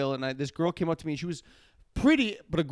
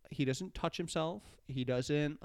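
ordinary talk, nothing marked

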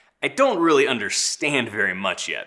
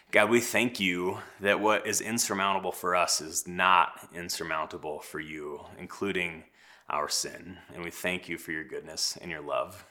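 low, soft praying voice; prayer; 5 semitones lower, 6 dB quieter than usual. Real, not acted. Pastor praying for his congregation before the reading, hushed prayer. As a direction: pray quietly and sincerely, dropping lower toward the amen.

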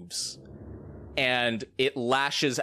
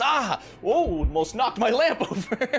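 merchant yells; 8 semitones higher than usual. Acted. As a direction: jump back and cry out over the lamp. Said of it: "just yells".

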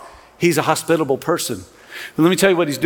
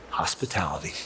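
whispered; 12 semitones lower, 12 dB quieter than usual. Real, not acted. Pastor's punchline answer to his question.

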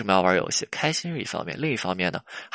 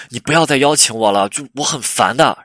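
irritated, raised-voice protest; annoyed girlfriend; 3 semitones higher, 6 dB louder than usual. Acted. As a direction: voice a fed-up girlfriend pushing him away. Acted annoyed girlfriend complaint.

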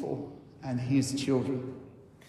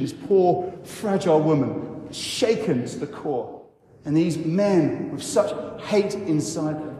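ordinary delivery

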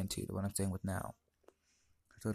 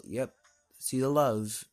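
mock-cheery, louder and higher on the last words; sarcastic retort; 4 semitones higher, 6 dB louder, slower than usual. Teen retelling the argument with mom, sarcastic. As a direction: toss it back with sarcasm.

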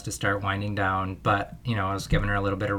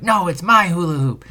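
higher, louder mock kid yell; imitating a yelling child; 7 semitones higher, 7 dB louder than usual. Dad imitating daughter yelling back at neighbor girl. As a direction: shout it back like a stubborn kid. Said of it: "my daughter was like".

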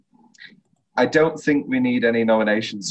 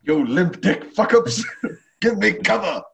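gruff movie quote; 9 semitones higher, 3 dB louder than usual. Retold or imitated. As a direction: bark it like a war movie sergeant. Quoting movie line.

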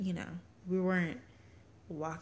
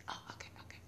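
quiet breathy whisper, imitating the lady; 14 dB quieter, much faster than usual. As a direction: whisper it as if caught out. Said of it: "and she was like".

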